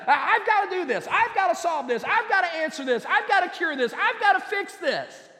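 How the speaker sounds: high, hurried-sounding overwhelmed outburst; frantic, out of breath. Acted. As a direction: act out someone frantic with too much to do. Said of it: "That's what being out of breath is".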